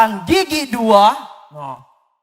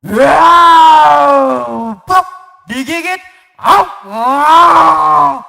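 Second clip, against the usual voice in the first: lion roars; 4 semitones higher, 10 dB louder, much slower than usual. Acted. Lion roaring in story.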